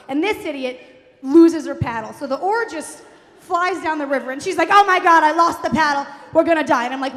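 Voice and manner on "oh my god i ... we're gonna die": loud, hammed-up panicked cry; mock panic. Mother who lost the paddle, loud mock panic. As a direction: imitate a mother in loud alarm.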